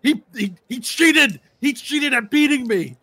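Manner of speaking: high, loud desperate wail of injustice; panicked whining shout. Acted. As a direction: scream it as he's being dragged away. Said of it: "and he goes".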